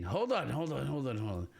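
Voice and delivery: ordinary talk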